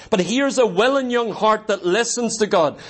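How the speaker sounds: ordinary talk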